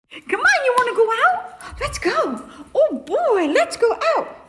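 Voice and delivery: high, loud, excited pet-talk; excited coaxing. Real, not acted. Owner urging the dog out, high and excited. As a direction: hype the dog up in a squeaky voice.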